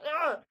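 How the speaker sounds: short high cry of mock despair; exasperated cry. Director giving up after another laughed take, mock despair. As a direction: let out a high frustrated yelp. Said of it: "He's like".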